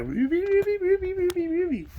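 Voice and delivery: very high repeated squeaky wees. Retold or imitated. Mimicking people squealing at the intern. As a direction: squeak like a pleased piglet. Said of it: "everyone's like".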